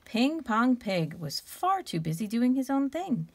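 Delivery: ordinary delivery, nothing marked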